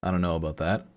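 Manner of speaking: ordinary talk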